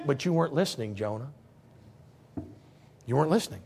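low, soft reproach; quiet rebuke. Acted. God to Jonah, soft low reproach. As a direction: drop down and correct him gently.

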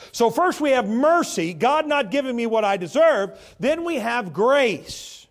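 loud, high preacher's cry; fervent revival preaching. Acted. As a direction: drive the point home with full force. Preaching at full force.

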